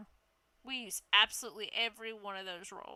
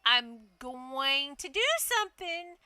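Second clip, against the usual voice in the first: loud, slow I-warned-you tone; defiant; 3 semitones higher, 5 dB louder than usual. Retold or imitated. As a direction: stand your ground. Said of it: "I was like".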